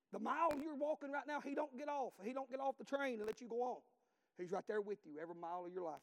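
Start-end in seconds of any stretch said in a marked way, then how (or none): 0.1-3.8 loud, high preaching. Acted. Preacher exhorting the congregation, full force. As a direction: drive it home.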